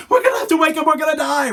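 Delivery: high, loud, frantic shout; panicked yell. Acted. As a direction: shout in terror at the others. Acted panicking disciple.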